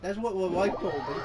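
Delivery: ordinary delivery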